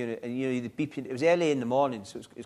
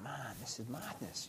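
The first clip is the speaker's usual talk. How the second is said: much softer and slow, almost under breath; stunned, muttered to himself; hushed, slow inner thought; 16 dB quieter, slower than usual. Retold or imitated. Newly admitted patient thinking to himself, hushed and stunned. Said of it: "I thought".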